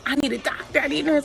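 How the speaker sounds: begging for help; rising panicky plea; urgent plea. Retold or imitated. Pleading on the call speaker.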